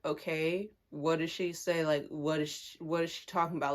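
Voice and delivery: low, soft retold reply; unfazed questioning. Player answers coach's bullying claim, unfazed. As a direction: keep it down, barely bothered.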